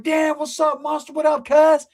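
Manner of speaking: high-pitched mock greeting of the homies; greeting brothers. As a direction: play it up like excited homies hailing their big man. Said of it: "Brothers is like".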